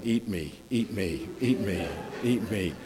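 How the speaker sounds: a soft, teasing donut voice, lightly voiced; tempting donut voice. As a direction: coax like a snack calling you, quietly. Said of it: "they just sit over there going".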